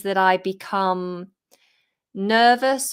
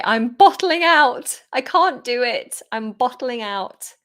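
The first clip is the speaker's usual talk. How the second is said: high, loud mock panic, easing off; hammed-up cold feet; acted panic; 5 semitones higher, 5 dB louder than usual. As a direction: cry out as if backing out of a jump. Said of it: "I would say".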